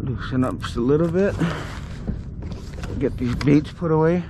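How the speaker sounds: usual speaking voice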